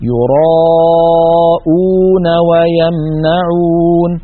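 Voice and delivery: يراءون ويمنعون chanted slowly, the long vowels held; drawn-out recitation. Shown as a demonstration. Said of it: "هذه الواو سبقت بهمزة مضمومة". (ترتيل بطيء مع مدّ الواو)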